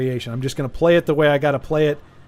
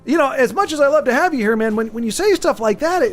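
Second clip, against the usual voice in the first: lifted, higher voice of a worried buddy; mock concerned friend; 8 semitones higher than usual. Host answering a chat comment as a worried friend, mock concerned. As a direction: play the disappointed old pal, hammed up a little.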